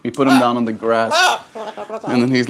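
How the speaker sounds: usual speaking voice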